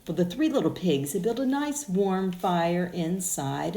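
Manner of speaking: ordinary delivery, nothing marked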